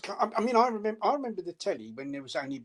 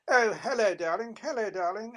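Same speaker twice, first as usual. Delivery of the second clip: mock posh darling, lightly put on; a hint of a plummy old movie star; posh old film voice; 6 semitones higher than usual. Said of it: "They all speak very, very posh".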